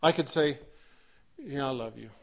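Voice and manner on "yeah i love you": "Yeah, I love you" tossed off, low and dismissive; grudging love you. Shown as a demonstration. Preacher showing a careless tone to spouses, dismissive. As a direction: say it like you don't mean it. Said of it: "The tone makes a difference".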